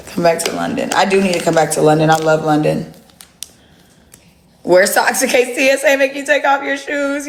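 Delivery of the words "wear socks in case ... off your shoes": mock advice. Real, not acted. Mock travel tip to hater.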